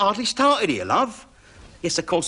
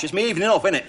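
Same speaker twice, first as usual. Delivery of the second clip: normal talking voice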